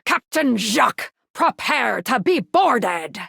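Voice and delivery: slow, drawn-out boarding command; mock pirate captain. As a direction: play at being a pirate on the attack. Mock boarding threat in game.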